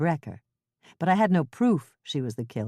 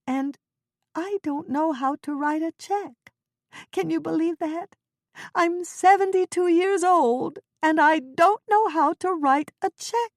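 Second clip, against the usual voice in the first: put-on upset old woman; 12 semitones higher than usual. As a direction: build to a helpless wail. Ethel voiced, upset.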